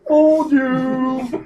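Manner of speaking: Dark Helmet. Retold or imitated. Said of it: "Gotta say it like Dark Helmet".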